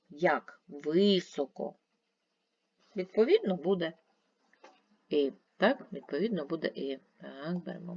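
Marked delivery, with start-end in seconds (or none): 0.7-1.7 "Високо" slowly, ВИ held long; stress on first syllable. Shown as a demonstration. Teacher repeating the check word, lengthened stressed ВИ in Високо. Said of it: "в наголошений".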